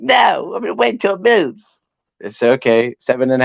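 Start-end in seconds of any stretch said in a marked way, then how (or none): 0.0-1.6 imitating Brandon. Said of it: "He's like".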